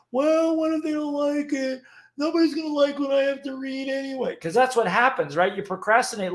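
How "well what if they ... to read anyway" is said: higher, put-on voice of the nagging inner critic; doubting inner voice. Speaker mocks the inner critic that stops writers, whiny put-on voice. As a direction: voice the little worrier in your head. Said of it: "resistance between your right ear and your left ear".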